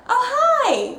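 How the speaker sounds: high-pitched, half-hearted polite hello; mock-polite high greeting; lukewarm greeting. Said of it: "It was just kinda like".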